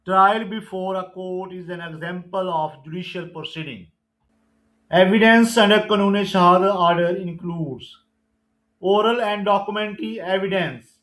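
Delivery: slow, deliberate exam-question read; measured MCQ recitation; slow quiz reading. Acted. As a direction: dictate each question and option slowly. Reads definition slowly.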